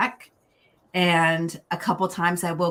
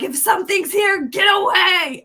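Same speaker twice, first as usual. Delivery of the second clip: much higher, louder shout; retold yell at a ghost; 13 semitones higher, 7 dB louder than usual. Yelling at the ghost in her bedroom, shouting. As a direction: yell it away from the room. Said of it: "started yelling at it".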